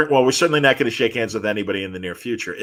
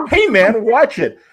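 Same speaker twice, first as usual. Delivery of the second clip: angry yell; 10 semitones higher, 5 dB louder, slower than usual. Retold or imitated. Peed-on guy yells at Superman.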